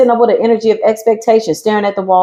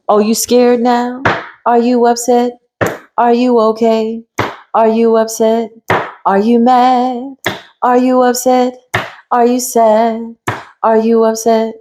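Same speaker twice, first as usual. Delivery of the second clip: higher, slower, sing-song mock concern; mocking taunt; 3 semitones higher than usual. Mocking an enemy with fake concern, higher, teasing. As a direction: taunt them with fake sympathy.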